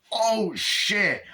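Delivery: high-pitched acted-out shock; shocked exclamation. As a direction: exclaim as at a shocking movie moment. Reacting to the gunshot moment.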